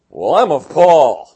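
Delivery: loud, slow, puffed-up boast; smug mock boast. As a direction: lean into the smugness, drag it out. Mocking proud church member.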